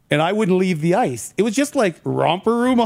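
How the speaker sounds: ordinary talk, nothing marked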